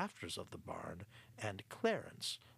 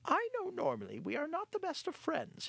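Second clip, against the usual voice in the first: high-pitched, sheepish little rat; a rat sheepishly asking the mice for trust; faster than usual. Acted. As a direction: apologize in a high squeaky voice. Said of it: "he began sheepishly".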